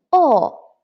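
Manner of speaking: O falling from high; fourth tone. Shown as a demonstration. Teacher drilling second line, o falling. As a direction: drop O loud and firm. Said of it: "And then the second line".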